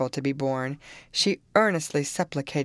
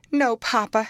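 higher, louder breathless no; gasped; 7 semitones higher, 5 dB louder than usual. A narrator's reading. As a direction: gasp it out in panic. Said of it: "she gasped".

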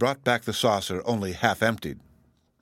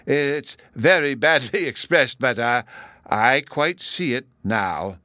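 slow, halting concession with a chuckle; genial, hesitant professor's voice; gracious; 5 semitones higher, 5 dB louder, slower than usual. A narrator's reading. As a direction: grant the point while dithering. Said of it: "the other professor graciously remarked".

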